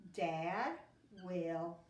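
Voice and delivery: each word said on its own with pauses; teacher dictating word by word; word-by-word dictation. Shown as a demonstration. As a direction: space out every word for spelling. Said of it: "Keep saying it to yourself".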